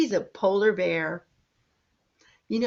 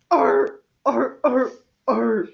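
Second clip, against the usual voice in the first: seal bark; 3 semitones higher, 9 dB louder, slower than usual. Shown as a demonstration. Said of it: "Can you do that?".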